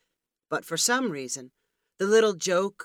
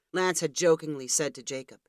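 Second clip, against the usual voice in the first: ordinary delivery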